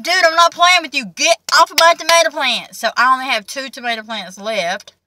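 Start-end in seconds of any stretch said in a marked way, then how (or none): 0.0-2.8 scolding the dog. Retold or imitated. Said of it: "I'm like".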